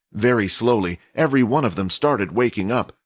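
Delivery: ordinary delivery, nothing marked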